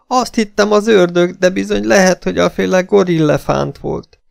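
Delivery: high-pitched, distraught woman's voice; wailing Esmeralda. A narrator's reading. Esmeralda answering about the beast, high wailing.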